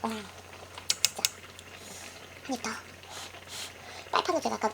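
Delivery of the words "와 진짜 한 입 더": breathy hushed whisper. Real, not acted. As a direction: breathe the words in awe. Quiet awe while eating.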